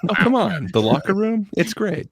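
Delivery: high-pitched incredulous objection; playful protest. Real, not acted. Playful protest after friend dismisses movie.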